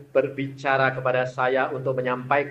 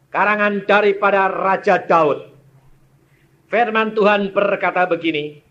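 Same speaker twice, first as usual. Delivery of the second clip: loud, raised scripture reading; proclaiming scripture; 7 semitones higher, 11 dB louder than usual. Acted. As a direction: announce the psalm to the congregation. Reading the psalm aloud.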